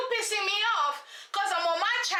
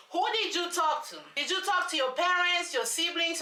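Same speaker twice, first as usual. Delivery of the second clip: ordinary talk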